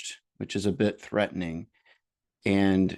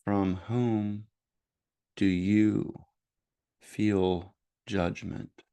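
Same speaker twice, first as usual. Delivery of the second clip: slow, measured meditation voice with long pauses; guided meditation; 5 dB quieter than usual. Real, not acted. Host leading listeners into noticing their judgments, guided meditation voice. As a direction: guide gently, leaving space after each phrase.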